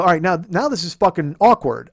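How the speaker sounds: loud, exasperated declaration; heated retold outburst; worked-up confrontation. Retold confrontation at the rant's peak.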